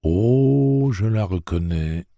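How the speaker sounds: drawn-out, unhurried reply; lightly voiced lazy drawl. A narrator's reading. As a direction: stretch the first word lazily, then ease down. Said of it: "répondit la voix traînante".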